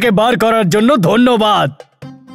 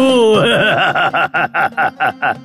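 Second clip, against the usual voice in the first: genie laugh; 4 semitones lower than usual. Acted. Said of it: "একটা Jimmy বাইরে এলো".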